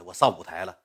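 plain reading voice

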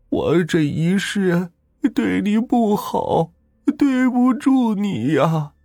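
dying wife apologizing to her husband; slow, rising to a crying wail; tearful farewell. A narrator's reading. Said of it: "这说着便哭出了声来".

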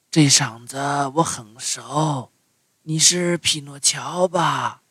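slow, soft fish voice; gentle sea creature speaking slowly; tuna voice. A narrator's reading.

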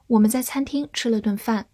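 ordinary talk, nothing marked

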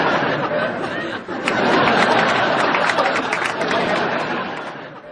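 long unvoiced fit of laughter; long breathy laugh. Real, not acted. Co-host laughing hard at the lobster joke, long breathy laugh. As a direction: let the laugh run out breathlessly.

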